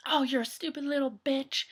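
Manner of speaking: restrained imitation of a yelling customer; mock angry yell. Customer cursing at new drive-thru girl, mildly mock yelling. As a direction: berate her, at half volume. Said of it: "proper yelling at her".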